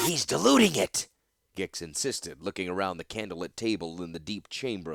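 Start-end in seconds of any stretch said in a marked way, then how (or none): 0.1-1.1 raised, urgent insistence; insistent Gix. Acted. Gix insists to the gathered exiles, urgent. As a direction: press the point hard.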